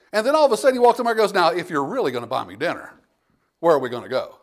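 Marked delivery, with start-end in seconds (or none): 1.3-4.4 lightly acted sceptic, pitched low; mock doubting guest. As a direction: drop low as an unconvinced invitee.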